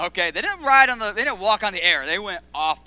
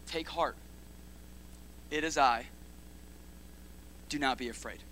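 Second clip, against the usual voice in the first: calm reassurance; 4 semitones lower, 9 dB quieter than usual. Retold or imitated. Jesus's calm words quoted.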